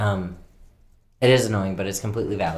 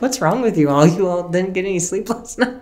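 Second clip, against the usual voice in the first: laughing quote; 10 semitones higher, 4 dB louder than usual. Retold or imitated. Parents' question, quoted while laughing.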